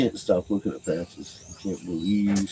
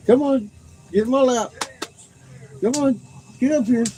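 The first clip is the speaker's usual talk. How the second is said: much higher, louder coaxing call; high dog-talk; 5 dB louder than usual. Real, not acted. Owner coaxing Patches into her lap, high pet voice.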